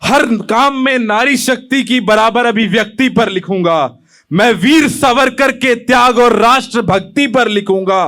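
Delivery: measured, declaimed patriotic verse; poem recitation. Acted. Young poet opens his poem to the audience, declaimed. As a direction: recite it to a hall, line by line.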